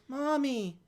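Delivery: put-on little kid's complaint; mock childish whine; whining kid. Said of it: "And the kid goes".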